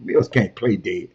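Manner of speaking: ordinary talk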